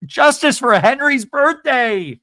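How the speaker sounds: high, loud, slow chant for Henry; rallying cry. Real, not acted. Vlogger rallying viewers behind Henry, loud slow chant. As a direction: declare it like a protest slogan.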